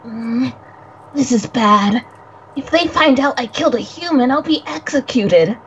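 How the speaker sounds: lightly voiced young boy, nervous and guilty; a hint of a jittery spirit boy who fears he killed someone; young boy character. Friend voicing the visual novel's boy, lightly nervous. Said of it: "Coco, please, please take it away".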